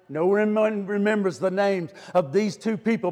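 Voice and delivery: ordinary talk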